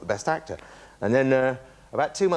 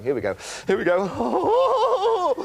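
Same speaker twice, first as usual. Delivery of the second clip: high, slow, laughing excitement; gleeful mock self-hype; giddy anticipation; 3 dB louder, much slower than usual. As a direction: squeak it out as you're about to win. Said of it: "and I'm going".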